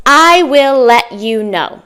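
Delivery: slow "I will let you know" with every word full; "I will let you know", uncontracted and word by word; careful full form. Shown as a demonstration. Teacher introduces the last phrase, slow full form. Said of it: "A native person might say".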